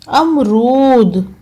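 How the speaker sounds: अमरूद said very slowly and drawn out; अमरूद stretched like dictation. Shown as a demonstration. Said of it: "dictation word for pupils".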